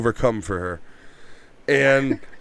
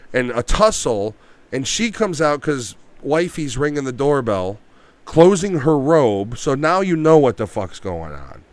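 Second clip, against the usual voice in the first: ordinary talk, nothing marked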